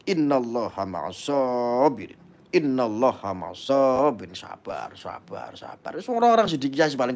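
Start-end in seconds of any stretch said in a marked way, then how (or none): 0.1-4.3 solemn recitation. Acted. Preacher quoting verse solemnly. 4.3-5.9 "sabar" said softer and lower each time; fading soft repetition. Acted. Preacher softly urging viewers to be patient, fading.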